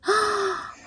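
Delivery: sharp gasp of surprise; surprised gasp. Real, not acted. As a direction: gasp at the reveal. Opening the day's book.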